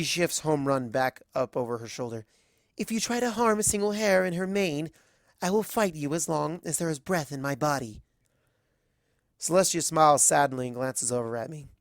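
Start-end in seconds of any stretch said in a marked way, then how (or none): 2.8-8.0 a touch of higher, firm defiance; a small mare standing her ground; defiant threat. Acted. Azalea warning Celestia, mild steely resolve.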